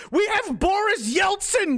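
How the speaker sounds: loud, slow, high announcement; shouting. Real, not acted. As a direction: belt out the name in disbelief. Mock announcing drunk leader.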